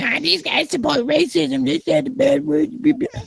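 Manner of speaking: very high-pitched whiny mimicry; mock complainers. Host mocking people who would accuse them, squeaky impression. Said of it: "have people going".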